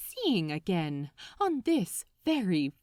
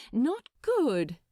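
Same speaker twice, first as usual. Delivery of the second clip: usual speaking voice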